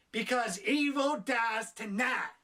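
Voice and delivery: slow, weighty movie-villain line; slow dramatic declaration. Retold or imitated. Reviewer mocking the bar speech, slow and dramatic. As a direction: drag out evil dies tonight with mock gravity. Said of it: "Mister Negan goes on stage".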